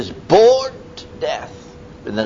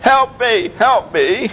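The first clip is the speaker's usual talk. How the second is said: a mild mock plea, higher; imitated cry for help; 10 semitones higher, slower than usual. People round about crying out, a mild mock plea for help. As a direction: plead lightly as a desperate stranger. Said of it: "they're screaming out".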